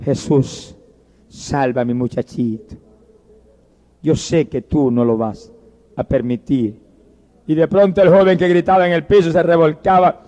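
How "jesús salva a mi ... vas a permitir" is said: faint, very low murmured prayer; slow, weak deathbed plea; dying mother. Acted. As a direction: plead softly with your last strength. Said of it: "Y la madre moribunda".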